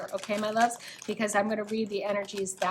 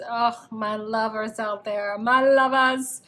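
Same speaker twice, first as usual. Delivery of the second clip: slow, drawn-out breathy lover croon; lightly hammed creepy seducer; creepy SNL lover voice; 6 dB louder, slower than usual. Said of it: "that sounded like that creepy S N L skit".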